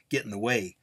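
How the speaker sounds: usual speaking voice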